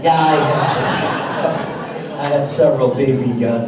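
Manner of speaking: amused, laughing as he speaks; chuckling confession. Real, not acted. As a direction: let the laugh spill over the words. Admitting he owns BB guns.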